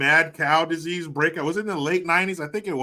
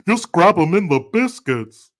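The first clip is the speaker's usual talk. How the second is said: loud Humpty-style catchphrase, pitched up; Humpty impression; 4 semitones higher, 8 dB louder than usual. Streamer quoting the [name], loud Humpty impression. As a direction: belt it out like Humpty. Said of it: "[name]".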